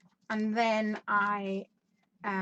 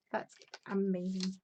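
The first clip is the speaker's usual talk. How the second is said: ordinary talk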